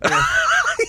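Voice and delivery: high squealing laugh; burst of laughter. Real, not acted. Laughing at speech jammer.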